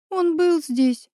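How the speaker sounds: high, slow, tearful voice; barely holding back sobs; a friend in tears, slow and broken; sobbing. Acted. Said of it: "всхлипнула Оля".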